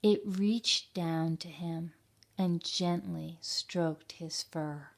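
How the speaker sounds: gentle storybook reading voice; story narration. A narrator's reading. Teacher reading a picture book to children, gentle narration. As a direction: read it like a bedtime tale to kids.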